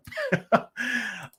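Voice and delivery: high chuckle at own joke; corny dad laugh. Real, not acted. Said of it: "I'm laughing at my own jokes".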